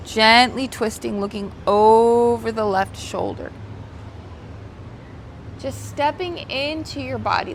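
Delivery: calm, unhurried yoga teacher cues; soothing voice leading a stretch; guided yoga instruction. Acted. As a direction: guide the class gently through each stretch.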